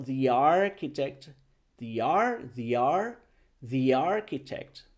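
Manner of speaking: "the architect" slowly as thee-yarchitect; diphthong link. Shown as a demonstration. Teacher drills the diphthong, linked thee. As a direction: run "the" into "ar-" as thee-yar. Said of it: "se crea un diptongo".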